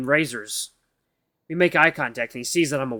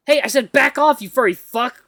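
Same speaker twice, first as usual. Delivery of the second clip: high, loud threatening shout; cornered and yelling at the husky; furious yell; 10 semitones higher, 7 dB louder than usual. Acted. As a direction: scream him back.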